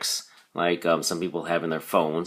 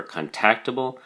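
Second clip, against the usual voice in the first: contactable as con-TAC-table; contactable, second syllable stressed; 3 semitones higher than usual. Shown as a demonstration. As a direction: put the emphasis on TAC in contactable. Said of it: "emphasis is on the second syllable".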